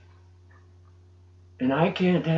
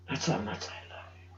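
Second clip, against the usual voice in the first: hushed; 6 semitones lower, 13 dB quieter than usual. Retold or imitated. Quoting Jesus's words softly.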